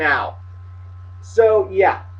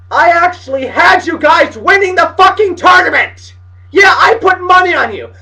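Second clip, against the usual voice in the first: high, loud betrayed-fan yell; indignant shout; 6 semitones higher, 4 dB louder than usual. Pretend. Bettor berating Notre Dame for losing, indignant shout. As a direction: holler it at the team that let you down.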